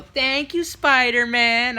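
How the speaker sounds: put-on high, drawn-out singsong child voice; kids in chorus. Kids obediently thanking Spider-Man, imitated singsong. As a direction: stretch it out like kids answering together. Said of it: "Then they would go".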